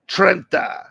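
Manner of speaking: "Trenta" with a light Italian lilt; Sicilian pronunciation. Shown as a demonstration. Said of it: "as they say in Sicilian".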